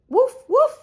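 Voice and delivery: high, loud yappy woof; puppy bark. Acted. Mum barking for the kids, high and loud. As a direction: yip it like a small excited dog.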